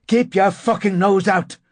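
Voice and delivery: high, loud, angry warning; shouted. A narrator's reading. Narrator to neighbour across the landing, angry shout. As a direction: yell it at a nosy neighbour. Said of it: "I shouted".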